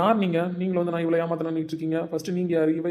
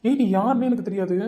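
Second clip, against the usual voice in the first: higher, heated outburst; angry denial; 6 semitones higher, 4 dB louder than usual. Acted. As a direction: snap at her to disown her. Man angrily disowns her. (கோபத்தில் குரல் உயர்த்தி மறுப்பு)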